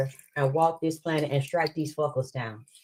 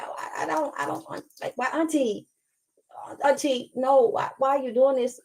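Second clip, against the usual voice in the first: small scared voice begging her auntie to stop; frightened little girl; 7 semitones higher than usual. Retold or imitated. Little girl begging her auntie, high hushed pleading. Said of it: "And she's like".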